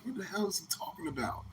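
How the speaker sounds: confused whisper under the breath; puzzled listener whispering in the audience. Retold or imitated. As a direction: whisper it to yourself, lost. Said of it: "You like".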